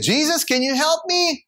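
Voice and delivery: high pleading call upward; climber pleading. Acted. Climber calling up to Jesus, high and pleading. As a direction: beg from the cliff. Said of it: "And said".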